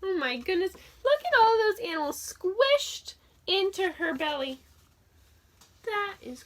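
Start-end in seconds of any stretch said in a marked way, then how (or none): none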